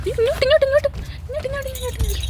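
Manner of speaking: high, eager call to the cat, ഇങ്ങോട്ട് repeated; calling a cat to come here in a high coaxing voice. Retold or imitated. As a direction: call the kitty over excitedly.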